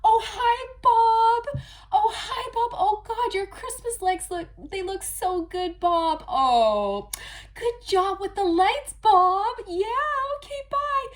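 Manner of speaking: very high, loud sugary greeting; fake sweet mom. Mom greeting neighbor Bob, sugary and very high. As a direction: gush fakely at the neighbor. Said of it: "she rolls down the window and she goes".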